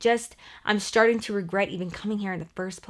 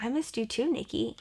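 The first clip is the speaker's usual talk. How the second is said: softer, gentle retold reply; soft admission; 8 dB quieter than usual. Estranged friend quietly admitting she missed her, soft. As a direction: let the guard drop quietly.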